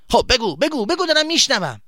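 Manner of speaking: high, loud, irritated prodding; impatient demand. Acted. Boy demands his friend's explanation, impatiently. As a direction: press him sharply to talk.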